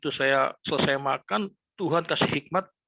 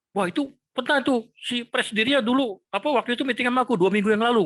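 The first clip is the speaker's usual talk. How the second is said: much higher and quicker, eager; excited son; 9 semitones higher, 4 dB louder than usual. Retold or imitated. Son telling his father about the meeting, excited. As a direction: imitate the son bursting with the news.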